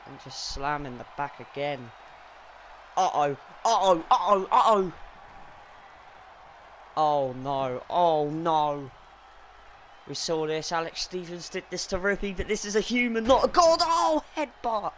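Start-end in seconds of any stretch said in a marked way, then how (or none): 3.0-8.9 alarmed shouting. Real, not acted. Alarm at incoming attack. 12.4-14.9 excited yelling. Real, not acted. Peak excitement over headbutt.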